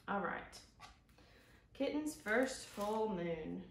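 ordinary delivery, nothing marked

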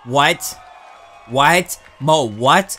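loud, disbelieving what; shocked shout. Real, not acted. Fan hearing the wrong champion, shouting in disbelief. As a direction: yell at the result in shock.

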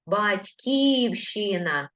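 Батьківщина said very slowly, syllable by syllable; slow dictation. Shown as a demonstration. Teacher dictating Батьківщина to pupils, very slowly. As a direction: stretch out Батьківщина so pupils can write it. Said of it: "від слова батько".

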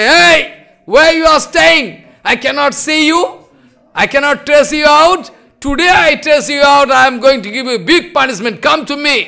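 high, loud threatening summons; calling out. Acted. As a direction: call him out of hiding, mock menace. Nityananda calls hiding Raghunath.

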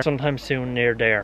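usual speaking voice

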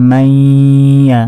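মাইয়া with a long nasal hold before ya; very slow মাইয়া, humming the middle; ghunnah. Shown as a demonstration. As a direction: hold the glide of মাইয়া through the nose. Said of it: "গুন্না করে পড়তে হবে".

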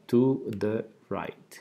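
"To the right" said slowly and carefully for repeating; slow clear teacher model of "To the right"; slow model pronunciation. Shown as a demonstration. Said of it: "Pronunciación nuevamente".

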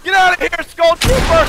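high, loud gamer scream; shrieked battle cry; yelling at an enemy. Real, not acted. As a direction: scream them away at full pitch.

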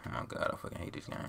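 hissed frustration under breath; frustrated whisper. Real, not acted. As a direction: whisper it angrily to yourself. Muttering after losing play.

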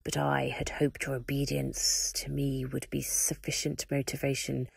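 dark lord scolding a servant; menacing Voldemort. Acted. Voldemort threatens the Warden.

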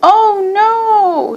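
high, drawn-out groan of dismay. A narrator's reading. Katie groaning over her part as the worm, dismayed. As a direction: groan it out long and high, like a mild wail. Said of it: "she groaned".

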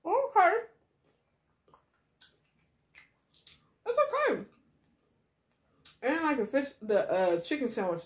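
eating between words; chewing while talking. Real, not acted. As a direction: mouth partly full. Seen 2: Chewing mouth shapes throughout; fry in hand, though the chewed food is unclear.

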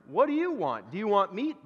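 a hint of an incredulous butcher; butcher questioning dog. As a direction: ask a dog as if it could answer.

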